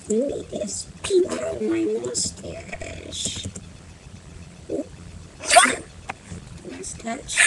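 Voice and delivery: slow, soft whisper. Pretend. Kid whispering through the effects filter, hushed. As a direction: breathe it out in a whisper.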